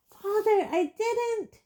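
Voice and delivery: high panicked shriek. Acted. Boy in chains shrieking to his father, high panic. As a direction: shriek your innocence. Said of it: "shrieked the boy in chains below".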